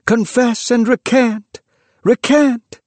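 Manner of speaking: high, loud demand to confess; crying out. A narrator's reading. A voice orders the man at the stake to confess, crying out. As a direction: cry out like an inquisitor. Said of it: "cried a voice".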